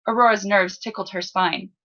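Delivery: plain reading voice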